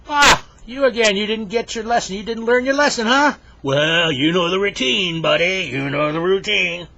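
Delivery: loud, high, taunting bear; mean bear. Acted. Bear catches Henry a second time, mean and taunting. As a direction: gloat and scold at full volume. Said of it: "This time the bear's really mean".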